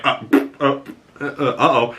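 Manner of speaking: stammering giggly uh-oh; mock nervous. Jen stammers when the lawsuits come up. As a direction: trip over the words, caught out.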